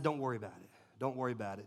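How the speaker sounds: soft, low and gentle; reassuring. Retold or imitated. Player calming the apologizing little boy, soft reassurance. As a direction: ease the boy's worry quietly.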